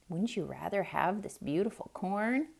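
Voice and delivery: usual speaking voice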